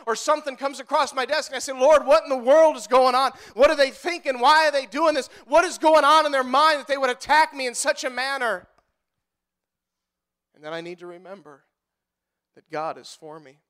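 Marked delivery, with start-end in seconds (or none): 1.7-8.7 loud, insistent questions to God; exasperated plea. Retold or imitated. Retold exasperated prayer under attack. 10.5-13.6 very low, soft self-reassurance; hushed reminder. Real, not acted. Sudden hush after the outburst.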